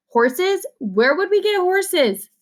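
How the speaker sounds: high, slow incredulous scoff; scoffing disbelief. A narrator's reading. Narrator scoffs at Shay's horse club, high and slow. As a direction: stretch it out, mocking the idea.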